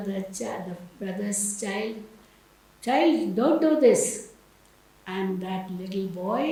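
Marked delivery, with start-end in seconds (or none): 2.9-4.0 loud, higher-pitched reprimand; stern order. Acted. Visiting uncle ordering his brother's child, stern command. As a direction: call the child off sharply. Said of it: "He told the".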